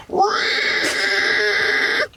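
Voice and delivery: high piercing pig squeal scream. Shown as a demonstration. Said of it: "literally just go".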